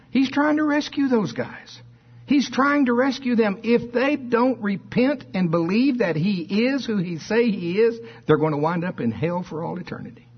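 high, urgent pulpit plea, easing down at the end; impassioned preaching. Real, not acted.

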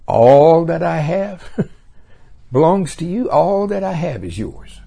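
high, slow, tender grandfather voice; loving grandfather. Acted. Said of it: "remember the lesson".

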